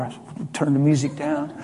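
ordinary delivery

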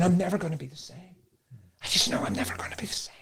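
soft, breath-only re-enactment; hushed awe. Retold or imitated. New convert repenting to God, hushed awe. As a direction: drop to an awed near-whisper.

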